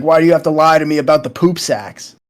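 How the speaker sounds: normal talking voice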